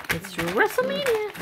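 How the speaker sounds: "WrestleMania" lifted high like a mock announcer; playful announcing. Real, not acted. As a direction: announce the puppy brawl for fun. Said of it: "Guess it's WrestleMania".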